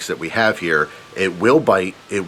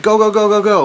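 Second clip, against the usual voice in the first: much higher, louder panicked go go go; urgent, yelling to get out of the boat; frantic urging; 11 semitones higher, 4 dB louder than usual. Retold or imitated. Son urging his dad off the boat, panicked high yell. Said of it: "and I'm like".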